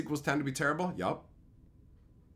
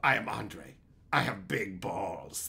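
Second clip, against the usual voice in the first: slow, low mock-serious line; a hint of a deep character voice; Andre; 9 semitones lower, 4 dB quieter, slower than usual. Streamer quoting a favorite movie line, slow low imitation. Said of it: "when Andre goes".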